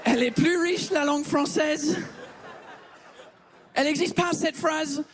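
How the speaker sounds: lightly put-on English accent in French; fake English accent. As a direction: play the Brit who mangles French. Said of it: "cet accent de merde". (faux accent anglais léger)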